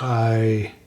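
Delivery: normal talking voice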